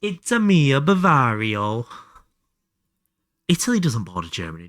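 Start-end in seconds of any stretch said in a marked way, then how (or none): 0.0-1.9 hammed-up Italian plumber voice, higher and louder; lightly hammed Italian accent; mock Mario accent. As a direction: play it like a cartoon Italian. Mario-style Italian voice joke.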